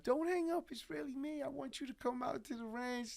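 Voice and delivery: high-pitched pop star impression. Star calling back, pleading to be believed, high imitated voice. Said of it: "he calls back the third time".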